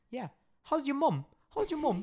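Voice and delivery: high-pitched imitation of a Scottish mom; high mock Scottish mum accent; mimicking mom's accent. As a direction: squeak it up like a friend's Scottish mother. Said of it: "the mom actually is like".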